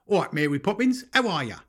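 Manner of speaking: high, loud mock Cockney; overdone bad Cockney chirp. Mocking a film actor's accent for wife, overdone Cockney.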